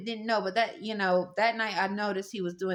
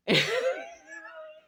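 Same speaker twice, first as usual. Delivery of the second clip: high laugh. Real, not acted. Laughing at her own name mix-up.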